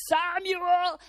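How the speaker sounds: loud, drawn-out call of a name. Acted. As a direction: call out to a sleeper. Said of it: "he hears the voice".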